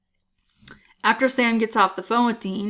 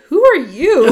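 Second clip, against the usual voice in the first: higher, louder confused cry; mock bewildered outburst; 6 semitones higher, 9 dB louder, slower than usual. Sam yelling at Gary's parents, half-laughing mock outburst. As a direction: demand it in a half-laughing panic. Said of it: "And he's like".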